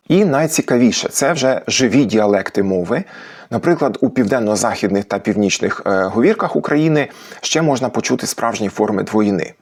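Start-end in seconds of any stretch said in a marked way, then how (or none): none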